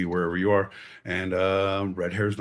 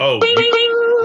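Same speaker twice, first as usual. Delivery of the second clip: very high, loud mock outrage; outraged shriek; 10 dB louder than usual. Cohost just called him idiot, a loud shriek. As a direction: squeal it in fake indignation.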